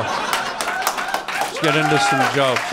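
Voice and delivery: let's get into some jokes, dragged out long; slow, drawn-out announcement. Shown as a demonstration. Comic telling the crowd, slowly drawn out. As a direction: stretch every word of it slowly. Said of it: "Here we go".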